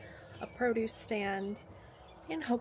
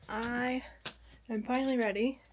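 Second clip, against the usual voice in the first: normal talking voice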